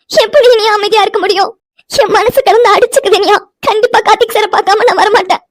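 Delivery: high-pitched tearful wail; crying. Acted. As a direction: sob the words out in distress. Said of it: "Vino, அழுகாதடி". (அழுதுகொண்டே புலம்பும் குரல்)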